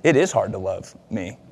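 a humbled husband conceding his wife's point; quiet realization. Retold or imitated. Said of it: "oh man, this is all about me".

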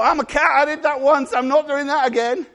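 the congregation as a cat saying no; stubborn cat voice. Retold or imitated. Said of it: "And you go".

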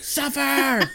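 high, loud creature screech; mock scream. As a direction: screech it like a tormenting locust. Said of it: "the thing's just screaming at him".

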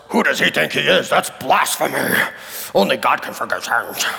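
hammed-up Pharisee grumble; grumbling Pharisees. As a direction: put on the voice of an indignant religious teacher. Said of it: "I imagine that's how they sounded".

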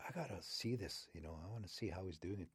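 soft eager murmur; wolverine murmuring. Acted. Wolverine tempted to peek, soft eager murmur. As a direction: sneak a thought to yourself.

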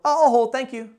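polite and firm; the caller answers the gatekeeper, nice but confident. Shown as a demonstration. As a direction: smile through it and stay put. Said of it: "very nicely but confidently".

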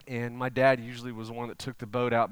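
usual speaking voice

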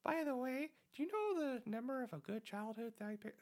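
put-on high, sheepish little kid voice. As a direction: play a small child asking innocently. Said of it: "I didn't know I had a good kid voice like that".

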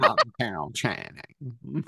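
slow, drawn-out showgirl voice; lightly voiced Carol Channing; Carol Channing impression. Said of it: "you think you're Carol Channing".

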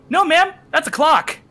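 high, loud, mock-polite sign-off; retold cheery kiss-off; triumphant comeback. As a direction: deliver the zinger to a rude customer. Triumphant comeback to rude customer.

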